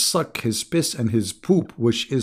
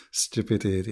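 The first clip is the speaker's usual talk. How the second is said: ordinary talk, nothing marked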